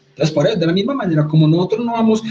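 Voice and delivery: usual speaking voice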